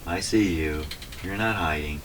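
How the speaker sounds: ordinary delivery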